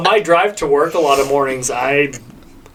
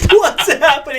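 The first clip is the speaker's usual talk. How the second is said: laughing; 10 semitones higher than usual. Retold or imitated. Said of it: "And they're like".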